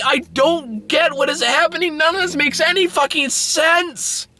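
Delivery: high falsetto incredulous ranting; mental breakdown. Real, not acted. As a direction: squeal in escalating, helpless bafflement. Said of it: "I'm having a mental breakdown".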